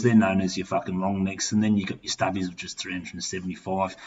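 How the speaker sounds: ordinary talk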